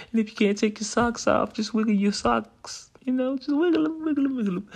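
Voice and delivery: high, singsong coaxing; high playful voice. Acted. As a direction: coax them up high and playful.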